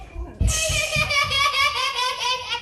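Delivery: high shrieking laugh. Real, not acted. Laugh at knock-knock.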